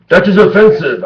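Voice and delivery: high, loud outraged mock; shrill offended voice. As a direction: squeal it like a scandalized prude. Mocking offended religious people.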